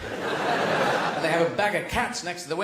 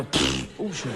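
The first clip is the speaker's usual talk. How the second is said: much lower, scoffing chef tossing fruit; dismissive expert; 7 semitones lower, slower than usual. Retold or imitated. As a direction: scoff low like a weary expert. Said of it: "you go".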